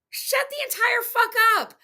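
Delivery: high, furious shout; angry. Real, not acted. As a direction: bark it up high in rage. Furious reply to chat clip.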